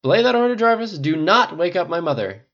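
loud, high countermanding order; urgent yell. A narrator's reading. Rhodey protecting his sleeping mother, urgent yell. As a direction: shout it down urgently. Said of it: "Rhodey yelled".

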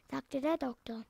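ordinary delivery, nothing marked

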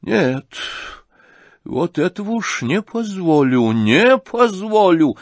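громко, с гневом, «не позволю» медленно; вскипает гневом; гневно. Acted. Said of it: "вскипела вдруг гневом". (генеральша в гневе запрещает, громче к концу)

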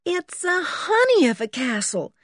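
high-pitched, delighted admiration; delighted gushing. A narrator's reading. Berta to George about the castle, gushing. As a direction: gush over it in a high voice.

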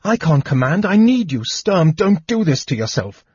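high, urgent, rising plea; frightened, desperate protest; terrified pleading. A narrator's reading. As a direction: beg him desperately, climbing in pitch. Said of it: "Lorana shivered with terror".

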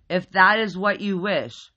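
loud, booming formal pronouncement; loud booming teddy bear. A narrator's reading. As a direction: boom it so it shakes the room.

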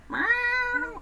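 high imitated cat meow. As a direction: meow like the cat calling from the next room. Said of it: "and he's like".

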